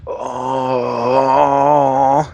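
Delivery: drawn-out villain groan; emperor groan. Retold or imitated. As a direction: groan like an old evil emperor. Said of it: "He does a lot of groaning".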